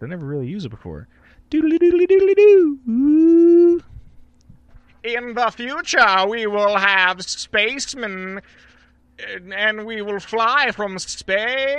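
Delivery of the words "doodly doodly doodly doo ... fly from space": much higher and louder robotic space voice, slow; sci-fi voice effect. Pretend. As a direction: announce the future like a cartoon spaceman. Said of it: "I really like that effect".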